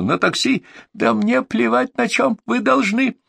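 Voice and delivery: high, slow furious yell; shrieking. A narrator's reading. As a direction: scream threats down the phone. Furious caller yelling.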